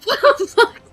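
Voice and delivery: high laughing disbelief; laughing shriek. Real, not acted. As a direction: squeal it through laughter.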